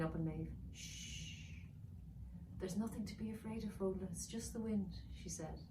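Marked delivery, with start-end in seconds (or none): 0.7-1.6 soft whispered shush; hushing. Acted. Mother dragon hushing her baby, whispered. 2.6-5.0 higher, softer soothing; affectionate. Acted. Mother dragon calming her baby, soft and higher. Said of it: "affectionately touching Fodla's nose".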